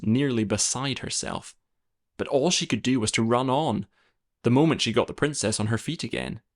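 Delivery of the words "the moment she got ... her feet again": usual speaking voice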